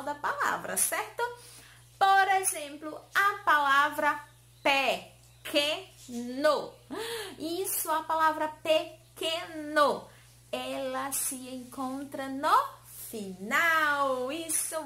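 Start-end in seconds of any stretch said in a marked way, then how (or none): 4.6-6.7 PEQUENO loud and slow, split pe-que-no; each syllable of pequeno stretched and set apart. Shown as a demonstration. Teacher sounds out syllables of word. 8.5-10.1 PEQUENO slowly broken into pe-que-no; syllable by syllable. Shown as a demonstration. Teacher repeats word in syllables.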